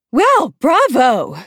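high, loud, drawn-out society gush; lavish praise in an affected trill; gushing escort. A narrator's reading. Escort delighted by the volunteer, gushing. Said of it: "gushes Effie Trinket".

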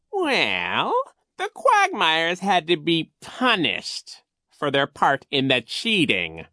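high, slow, loud villain voice; scheming Coach Genghis. A narrator's reading. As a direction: feign puzzlement with drawn-out villainy. Narrator plays Coach Genghis.

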